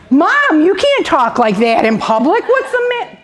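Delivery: high, loud exasperated outburst at Mom; hammed-up embarrassed protest; mock scolding. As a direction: play a mortified son telling Mom off. Acting a son scolding his mother.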